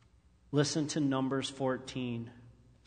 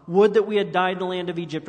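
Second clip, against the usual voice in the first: loud, fast, higher grumbling wail; fast mock complaint; 5 semitones higher, 8 dB louder, faster than usual. Preacher acting the Israelites' complaint, loud and fast. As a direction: rush it out in a whine.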